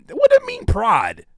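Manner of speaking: high disbelieving what; baffled high mock question; incredulous question. Real, not acted.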